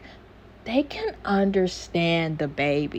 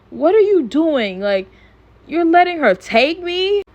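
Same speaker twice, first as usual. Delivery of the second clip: high, louder mock disbelief; retold indignant protest; 9 semitones higher, 5 dB louder than usual. Stewie protests to Peter as he's carried off, high mock indignation. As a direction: imitate an affronted protest, pitched well up.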